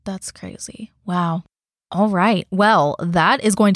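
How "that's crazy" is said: slow, stunned whisper. Real, not acted. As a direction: whisper it slowly in disbelief. Shocked reaction to death story.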